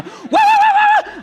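mock wail, very high and very loud; hammed-up high wailing yell; Pentecostal yelling. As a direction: imitate a woman yelling in the Spirit at full force. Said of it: "Pentecostal yelling".

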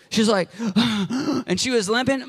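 a sharp imitated gasp; mock gasp. Preacher showing the asthma attack, a mock gasp. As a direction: act out her struggle for breath.

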